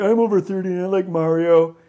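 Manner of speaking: troll. Retold or imitated. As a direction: pitch it up, mimicking a protesting troll. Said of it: "before you troll us and say".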